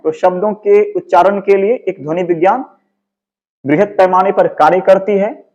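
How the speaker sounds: ordinary delivery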